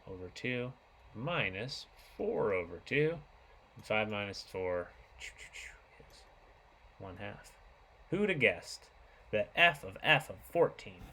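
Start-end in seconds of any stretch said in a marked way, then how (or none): 3.8-7.5 slow whisper under the breath. Real, not acted. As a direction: whisper the arithmetic slowly to yourself. Working a sum under breath.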